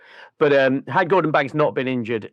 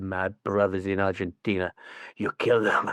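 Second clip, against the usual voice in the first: put-on drunk neighbour quote; 7 semitones lower, 7 dB quieter than usual. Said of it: "He's like".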